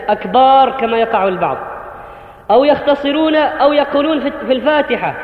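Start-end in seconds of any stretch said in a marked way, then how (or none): 0.1-0.7 أكبار with a long drawn aa; lengthened akbaar. Shown as a demonstration. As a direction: stretch the second vowel of أكبار. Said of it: "وليس بالألف". (أكبار بمدّ الألف)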